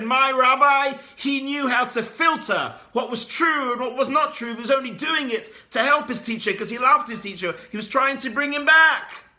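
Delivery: high, loud fervent defence; impassioned shout. Acted. Student defending his rabbi to Elijah, loud passionate shout. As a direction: shout out your teacher's innocence.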